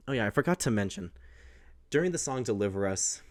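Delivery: ordinary talk, nothing marked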